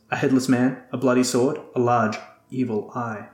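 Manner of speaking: usual speaking voice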